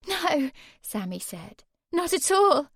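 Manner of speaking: high, loud protest; insist, close to tears; upset denial. Acted. Sammy denies, upset.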